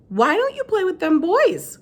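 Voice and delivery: a hint of elderly Southern woman; old woman Gloria. A narrator's reading. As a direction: ask it like a kindly old lady. Gloria voiced by narrator.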